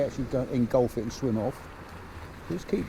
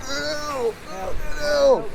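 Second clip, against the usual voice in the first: high-pitched mock "ew" cry; short, high yelps imitating a surprised angler; imitated startled cry; 12 semitones higher than usual. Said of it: "You will go".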